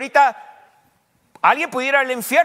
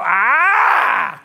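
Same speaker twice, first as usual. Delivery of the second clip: eureka ah; 4 semitones higher, 4 dB louder than usual. Pretend. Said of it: "ustedes me hubieran dicho".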